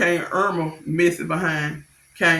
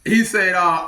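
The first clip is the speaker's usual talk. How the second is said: ordinary talk, nothing marked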